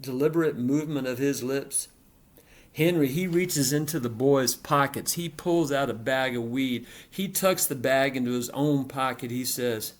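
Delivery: plain reading voice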